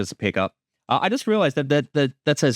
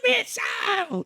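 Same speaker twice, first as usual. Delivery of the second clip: excited shout. Real, not acted.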